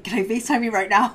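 eager rush, voice up; retold eager request. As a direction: imitate a friend bursting to call. Quoting friend's eager request.

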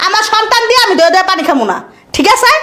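high, heated declaration; indignant, emphatic mother laying down terms; angry ultimatum. Acted. Mother gives son an ultimatum.

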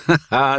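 loud, high belly laugh; howling laugh. Acted. Thug laughing at the girl, howling laughter. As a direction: throw back a big mocking laugh. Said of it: "howled with laughter".

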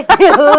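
laughing out loud, high; real laugh breaking out; loud amused laugh; loud burst of laughter. Real, not acted. Laughs after quoting husband.

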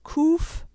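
Kuf with a hard throaty k; Hebrew letter Kuf. Shown as a demonstration. Teacher modelling Kuf for learners to repeat. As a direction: say Kuf slowly for the class to echo. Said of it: "Repeat after me".

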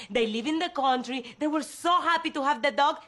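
ordinary talk

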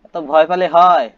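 put-on loud, slow teasing call at a scared baby; mock scolding shout. Player loudly teasing the frightened game baby.

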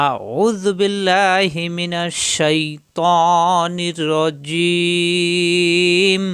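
very slow, drawn-out chanted recitation; Quranic recitation. Acted. Teacher opening the Quran lesson: slow, drawn-out recitation. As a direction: recite the opening formula in slow, stretched tajweed.

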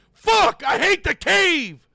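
sudden loud, high outburst; frustrated yell. Real, not acted. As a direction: blurt it out in rage. Dies in game, yells.

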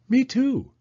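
put-on high, eager child's voice; little girl Penny. Penny asking Mama about snow, high childlike voice. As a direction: ask like an impatient little girl.

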